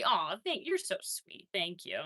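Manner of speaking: normal talking voice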